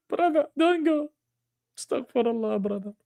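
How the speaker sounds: hammed-up plea trailing off; mock pleading fading. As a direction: let the mock begging fade out softly.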